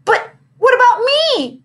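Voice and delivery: high, loud slow wail; girl wailing at the teacher, high and slow. Acted. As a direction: wail it like a left-out child. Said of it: "wailed Victoria".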